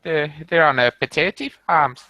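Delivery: high, lilting mock Irish accent; playful Irish accent impression. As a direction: put on a jokey Irish accent. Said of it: "they're a bit more like this".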